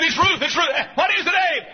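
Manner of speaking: high, loud, near-shouted panic; frantic shout. Retold or imitated. Husband and preacher on phone: frantic high shouting. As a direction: yell it down the phone line.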